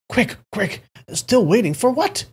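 high-pitched, impatient hurrying; urging. A narrator's reading. Elderly man rushing out of the house, urging anxiously. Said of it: "He kept urging".

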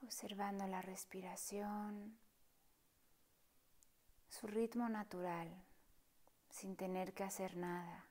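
soft, partly whispered meditation voice; hushed calm guide inviting stillness; guided meditation. Acted. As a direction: guide them gently through the breath, unhurried. Leads a breathing meditation.

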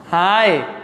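ordinary talk

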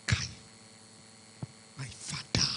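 soft unvoiced whisper; whispered plea. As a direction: breathe it out as a plea.

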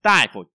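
đại hit loud and falling, phổ light; stress on đại. Shown as a demonstration. Teacher models the word đại phổ, stress on đại. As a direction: punch đại, let phổ drop away. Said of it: "trọng âm rơi vào cái từ này".

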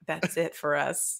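hushed mock despair; mock doom. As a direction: sigh it out like all hope is gone. Mock doom about feared hounds.

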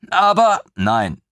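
put-on high, bright-eyed young officer, gushing; a thrilled lieutenant who can't believe his luck; eager lieutenant voice. Lieutenant gushing over the queen's griffin, high and eager.

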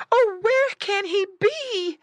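high, slow wail of alarm; loud, high distressed pig voice; frightened mother pig cry. A narrator's reading. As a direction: cry out as a panicked mama pig. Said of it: "cried Missus Pig".

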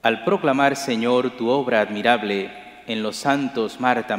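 usual speaking voice

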